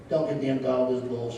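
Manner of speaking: normal talking voice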